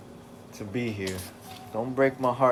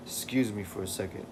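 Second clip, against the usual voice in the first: ordinary delivery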